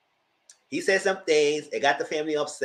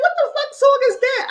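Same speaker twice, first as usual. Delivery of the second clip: incredulous squeal; 7 dB louder than usual. Retold or imitated. Mocking a song in disbelief.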